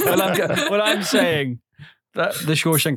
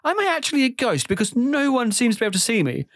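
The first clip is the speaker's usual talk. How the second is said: mock panic; 7 semitones higher than usual. Retold panicked inner thought.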